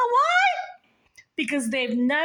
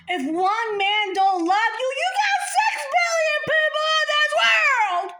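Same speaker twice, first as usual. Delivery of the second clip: much higher, louder preaching shout; Jesus shouting; 4 dB louder than usual. Pretend. Jesus loudly comforting the rejected girl. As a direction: cry it out like a revelation. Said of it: "Jesus, like".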